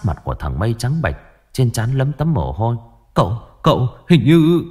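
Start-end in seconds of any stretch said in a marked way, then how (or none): 3.1-4.6 stammering fear. A narrator's reading. Frightened servant stammering bad news.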